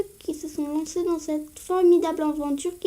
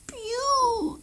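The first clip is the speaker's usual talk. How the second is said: cri aigu et mignon de petite créature; petite voix de Cosmoc; 8 semitones higher, 4 dB quieter than usual. Acted. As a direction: piou tout aigu, comme une peluche. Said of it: "J'adore l'imiter avec des petites voix". (petite voix aiguë mignonne)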